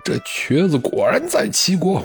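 high-pitched outcry without extra volume; acted cry of alarm. A narrator's reading. Pang Juan sees the Sun banner, acted high cry of alarm. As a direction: cry out high, as a general realizing he's been tricked. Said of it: "庞涓大叫一声".

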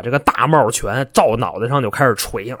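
ordinary delivery

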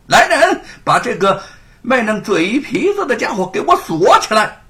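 high, loud command to his men; bellowed order. A narrator's reading. Constable ordering the arrest: bellowed command. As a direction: roar for the chains.

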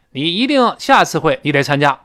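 usual speaking voice

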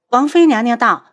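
loud, pointed announcement; displeased reminder. Acted. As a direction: call it out to rebuke them. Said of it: "不悦地出声提醒". (不悦地高声提醒)

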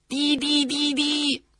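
slow mechanical beeping; imitated signal beeps. Warship signalling the ship ahead, slow beeps. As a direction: tap out a radio signal.

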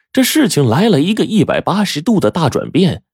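ordinary delivery, nothing marked

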